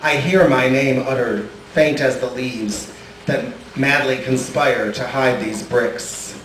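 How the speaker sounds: ordinary talk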